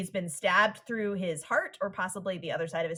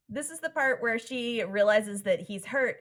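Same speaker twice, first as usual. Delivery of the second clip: ordinary talk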